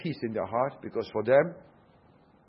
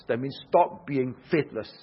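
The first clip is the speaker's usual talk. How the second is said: normal talking voice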